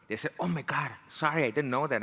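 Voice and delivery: ordinary delivery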